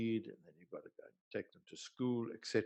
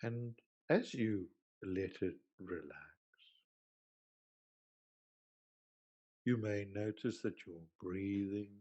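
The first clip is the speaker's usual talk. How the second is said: guided meditation; 2 semitones lower than usual. Acted. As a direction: ease them gently down, leaving space between phrases. Leads a guided relaxation.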